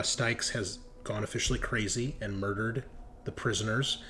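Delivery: normal talking voice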